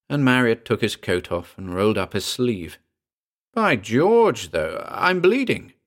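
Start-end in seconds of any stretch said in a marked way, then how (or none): 3.5-5.7 high-pitched cry of surprise; startled exclamation. A narrator's reading. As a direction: exclaim it, voice leaping up. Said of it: "he exclaimed".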